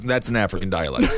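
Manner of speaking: deep, deadpan parody; mock dismissive voice. Mocking the book's author, low and deadpan. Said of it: "She's like".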